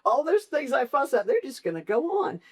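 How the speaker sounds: high, frantic what-if voice; mock panicked worry. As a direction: spiral into a comic panic about everything falling apart. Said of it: "I think all the time".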